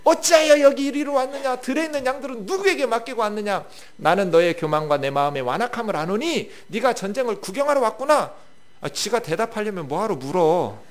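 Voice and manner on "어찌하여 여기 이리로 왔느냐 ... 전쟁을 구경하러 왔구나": higher, louder rush of questions with no pause for answers; rapid-fire accusation. Acted. Eliab scolding David without letting him reply. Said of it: "자기가 대답해버려요".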